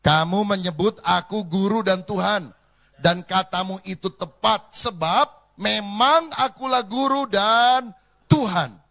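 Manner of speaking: loud, slow, unison scripture reading; congregational reading aloud. Real, not acted. Congregation reading scripture together, loud and slow. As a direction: read it out together with the church. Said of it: "Kita baca bersama".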